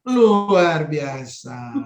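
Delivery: slow, low, drawn-out wonder; drawn-out praise. Acted. Teacher praising a child's answer, slow amazed drawl. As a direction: stretch it out like a delighted teacher.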